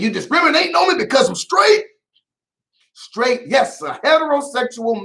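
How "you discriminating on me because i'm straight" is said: higher, fast, outraged yell; mock indignant shout. Host mimicking the straight man suing NBC, shouting. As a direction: blurt it like a man who feels wronged. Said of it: "He's saying".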